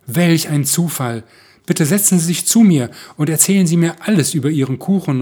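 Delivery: lauter, höherer, langsamer Jubel; begeistert. A narrator's reading. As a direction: freudig überrascht einladen. Begeisterter Mann lädt sie ein. (begeistert und laut)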